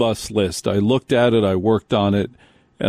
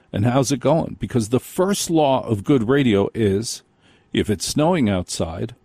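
ordinary talk

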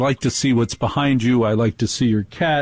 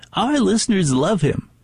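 mock radio executive; 7 semitones higher than usual. Mocking exec's reply.

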